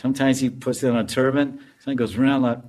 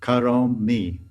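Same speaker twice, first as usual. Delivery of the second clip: ordinary talk, nothing marked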